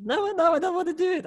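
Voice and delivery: high mock-scared volunteer voice; reluctant volunteer. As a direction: squeal like a nervous audience member. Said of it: "I'm getting in character".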